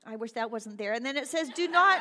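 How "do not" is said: "not" hit loud and high; "Do not" as a loud, high command; emphatic command. Acted. Preacher quoting the verse to the congregation, emphatic.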